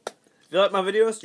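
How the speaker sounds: loud, high pitch opener; hyped-up ad voice. Acted. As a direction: sell it like a TV ad. Ad parody opening hype.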